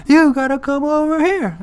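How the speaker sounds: high, loud, drawn-out holler; imitating a tiny guy's long yell; imitated yelling. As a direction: holler across the jail, stretching the words. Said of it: "just yelling the same thing".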